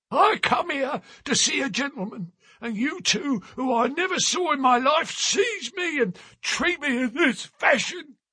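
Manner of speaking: put-on high, wounded old man's complaint; a wronged old seaman grumbling; aggrieved old sailor. A narrator's reading. Old sailor complains to the two men, aggrieved and high.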